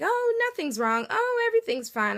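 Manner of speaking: high, sing-song fake cheer; mocking a sugary nothing's-wrong; mock chipper denial. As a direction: brush it off in a high false-bright voice.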